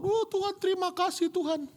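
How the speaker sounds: very high, crying thanks to God; imitated tearful prayer. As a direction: re-enact being moved to tears in prayer. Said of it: "saya nangis dilawat Tuhan". (doa syukur tiruan sambil menangis)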